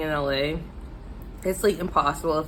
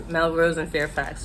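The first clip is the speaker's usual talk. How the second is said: usual speaking voice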